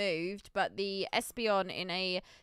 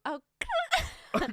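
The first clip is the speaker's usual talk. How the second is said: much higher squeaky attempt at the creature cry; imitating the Celebi cry. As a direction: try to copy the high Pokemon cry. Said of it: "how do you do that?".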